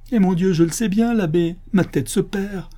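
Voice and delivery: high-pitched agitated admission; distraught cry. Acted. Canon admitting madness to the abbot, distraught.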